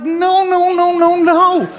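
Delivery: high, laughing panic; frantic refusal. Retold or imitated. Refusing to be arrested.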